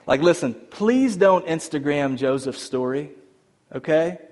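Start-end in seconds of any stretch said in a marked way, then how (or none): none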